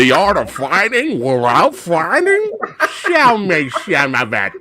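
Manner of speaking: very slow, mock New Zealand accent; hammed-up New Zealand accent. Guest imitating the actor on the boat, hammed-up New Zealand accent. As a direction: stretch every word in an exaggerated New Zealand-Australian accent. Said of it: "Be-, best New Ze-, New Zealand accent ever".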